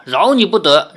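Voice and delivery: acted angry grandmother; menacing threat. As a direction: pronounce it as a stern threat.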